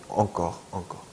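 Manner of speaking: encore as "ong-kor", soft final r; original French pronunciation. Shown as a demonstration. Teacher repeating the French original, encore as "ong-kor". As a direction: say encore with dark nasal "eong". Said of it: "프랑스 원래 발음은 엉이 된다는".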